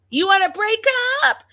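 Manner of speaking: very high, loud mimicked scream; retold scream. Vlogger imitates wife repeating her challenge, full mock scream. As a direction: escalate into a high-pitched yell, imitating her. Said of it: "she's screaming".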